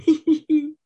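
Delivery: shrill hee-hee giggle; high giggle. A narrator's reading. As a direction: titter up high. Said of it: "Kitty laughing".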